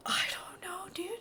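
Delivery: put-on high, whispery boy voice; Jeff. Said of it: "Jeff's like".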